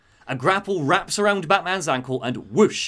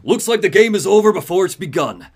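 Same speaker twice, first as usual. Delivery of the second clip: loud, higher villain taunt; Deathstroke; 5 semitones higher, 8 dB louder than usual. Acted. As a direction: taunt him with forceful relish. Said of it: "and he goes".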